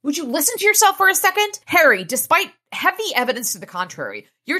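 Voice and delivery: very high, loud and fast; exasperated rant. Acted. Elaine scolding Harry about trusting a vampire, high and fast. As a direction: berate him fast.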